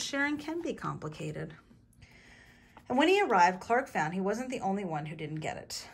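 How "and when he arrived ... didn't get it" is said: plain reading voice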